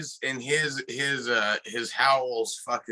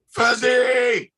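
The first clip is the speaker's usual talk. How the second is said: high, loud drawn-out shout; retold shout across a distance; calling out; 13 semitones higher, 5 dB louder than usual. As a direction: holler the name to a friend far off. Calls out to a lost friend.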